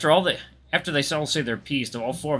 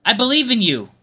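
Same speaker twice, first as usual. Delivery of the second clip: louder, higher heartfelt cheer; speaking up; 11 semitones higher, 8 dB louder than usual. A narrator's reading. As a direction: call out encouragement on a sudden urge. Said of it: "an urge to speak up".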